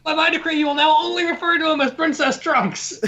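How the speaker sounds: high, pompous mock decree; mock royal decree. Co-host quoting a royal decree, high and pompous. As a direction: proclaim it grandly, tongue in cheek. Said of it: "She is like".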